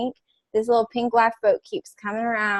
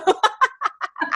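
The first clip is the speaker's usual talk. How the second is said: high burst of laughter; 13 semitones higher than usual. Real, not acted. Laugh after her own quote.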